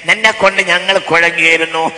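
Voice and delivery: loud chanted sermon with drawn-out endings; sing-song preaching. Acted. Preacher reciting scripture to the congregation, chanted preaching. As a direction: preach it out, stretching the last syllables.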